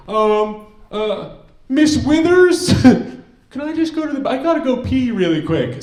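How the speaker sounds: high, loud mock kid voice; hammed-up urgent classroom request; pleading schoolkid. Student asking Miss Withers for the bathroom, mock pleading.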